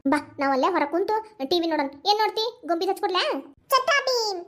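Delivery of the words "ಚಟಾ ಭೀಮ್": put-on high-pitched toddler voice; eager little child. Child asking grandmother for a cartoon, eager. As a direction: pipe up like a small child.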